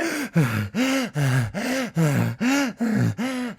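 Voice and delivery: hammed-up panting, desperate for breath; mock gasping. Exhausted swimmer clinging to lifeguard's board, gasping.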